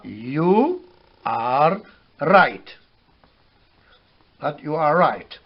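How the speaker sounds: word by word "you are right"; English phrase demo. Shown as a demonstration. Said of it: "On dit".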